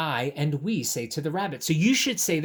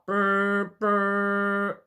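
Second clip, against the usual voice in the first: engine brr; 5 semitones higher, 4 dB louder than usual. Pretend. Tugboat engine noise.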